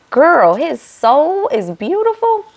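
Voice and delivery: high, slow disbelieving question; incredulous. Retold or imitated. Vlogger reacting to the bride's vows, incredulous. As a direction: stretch it out in disbelief. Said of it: "I was like".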